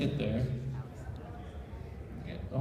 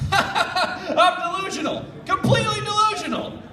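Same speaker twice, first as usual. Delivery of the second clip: high, slow, laughing disbelief with a shout; laughing hysterically; 4 dB louder than usual. Acted. Doogie laughing at his own delusion, high laughing shout. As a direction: cackle through the words in disbelief. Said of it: "started laughing".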